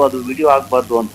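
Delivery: normal talking voice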